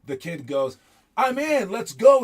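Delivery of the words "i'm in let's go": high, loud cocky hype; a loud, cocky kid shouting that he's in. Retold or imitated. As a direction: brag like a kid who thinks he's made it. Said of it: "He's kinda cocky".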